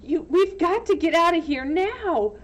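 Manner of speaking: high loud urgency; urgent. Acted. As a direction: hurry him away. Urging Sam to flee.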